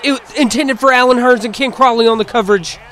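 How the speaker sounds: keyed-up sportscaster; excited play-by-play. Real, not acted. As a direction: call the broken-up pass with energy. Calling a pass broken up.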